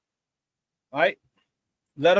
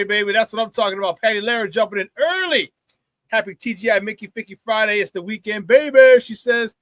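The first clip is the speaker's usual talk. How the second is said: usual speaking voice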